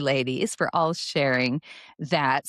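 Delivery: normal talking voice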